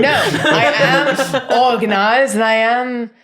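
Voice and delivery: giggling through a boast; defensive, laughing it off. Acted. Suspect boasts while laughing.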